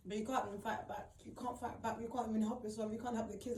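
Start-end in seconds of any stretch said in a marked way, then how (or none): none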